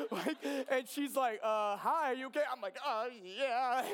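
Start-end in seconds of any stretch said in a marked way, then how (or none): 1.4-2.4 high, loud imitation of her concern; puzzled greeting. 2.9-3.8 high, loud, awkward mock reply; fighting back tears. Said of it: "trying not to cry".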